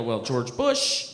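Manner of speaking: a person corrected over grammar answers back slowly; slow defensive reply. Retold or imitated. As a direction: drag it out, offended. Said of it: "they go".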